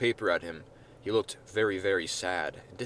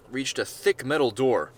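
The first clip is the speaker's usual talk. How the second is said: usual speaking voice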